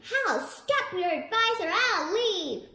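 high, loud scream. A narrator's reading. The body screams at the house to stop, loud high scream. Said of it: "he screamed".

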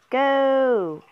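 loud, high drawn-out call; urging shout. Real, not acted. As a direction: shout it to send them off. Urging on a player.

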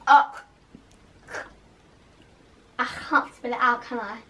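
talking while chewing; sticky food in mouth; mouth full. Real, not acted. Seen 3: Holds bitten mochi, mouth seems to have food while speaking.

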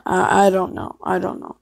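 ordinary delivery

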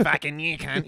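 hammed-up Aussie accent; broad Australian accent. Shown as a demonstration. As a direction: demonstrate an exaggerated Australian accent. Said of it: "it just is Australian as fuck".

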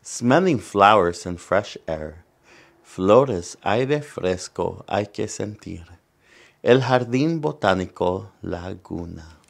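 lively bilingual picture-book reading; reading to children. Acted. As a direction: read it out to the kids, bright and clear. Reads picture book aloud.

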